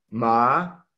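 MA stretched and gliding upward; second tone, a long rising MA. Shown as a demonstration. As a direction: let MA climb like a question. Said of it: "con el tono ascendente o segundo tono".

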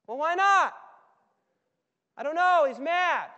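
high, loud and slow; shouted correction. Acted. Servant telling the dad over the music. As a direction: shout it slowly over the party. Exchange over party noise.

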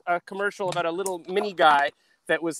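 ordinary talk

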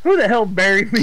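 loud, high, outraged yell; indignant shout. Real, not acted. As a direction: shout in angry disbelief. Finds himself buried by friends.

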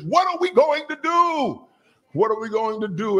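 high, panicky cry of what to do; panicked servant. Acted. Frantic high plea. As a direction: servant begging Elijah in panic, voiced high and anxious.

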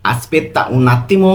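"Aspetta un attimo" said very slowly; slow model reading. Shown as a demonstration. Teacher reading the first Italian option very slowly. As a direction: read the option slowly and clearly. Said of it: "এর Italian কি হবে?".

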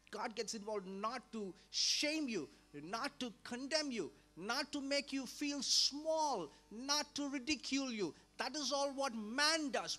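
each not punched, slow and rising in level; slow emphatic build. Real, not acted. Preacher assuring listeners, slow and emphatic. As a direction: hammer out the list slowly.

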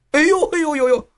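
high, repeated ow-ow wail; yelping in pain. A narrator's reading. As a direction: wail over the wounded leg, far up in pitch. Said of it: "听得丈夫呼痛".

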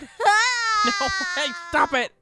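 mock crying. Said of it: "I cried enough for both of us".

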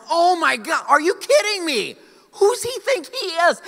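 high, loud, outraged shout at another driver; road rage outburst. Retold or imitated. Pastor reliving rage at a speeding driver, outraged shout. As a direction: yell it like he just cut you off. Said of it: "And I thought to myself".

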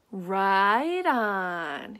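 laid-back lightly voiced cat; a hint of a groovy cat; cool cat voice. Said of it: "he said".

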